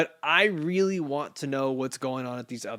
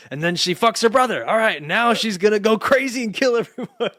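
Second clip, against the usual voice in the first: loud, high and laughing plot pitch; mock excited pitch; 8 semitones higher, 9 dB louder than usual. Host teases cohost pitching page twenty, loud mock excitement. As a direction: belt out a wild story idea through amusement.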